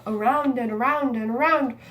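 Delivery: fast, rushing repetition; speeding up. Shown as a demonstration. Teacher showing kids how fast reading sounds. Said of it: "I continued to speed up and I got really fast".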